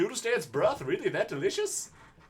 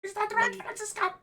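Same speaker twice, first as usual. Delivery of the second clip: falsetto. Acted. High falsetto mocking voice.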